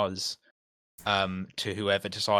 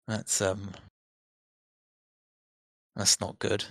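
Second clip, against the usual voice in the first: put-on moody teenager. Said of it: "very moody teenager".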